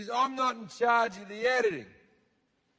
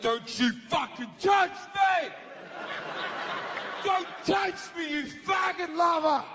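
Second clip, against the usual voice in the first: much higher yelled warning; furious God; 9 semitones higher than usual. Acted. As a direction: shove them off with your voice. God refuses to be touched.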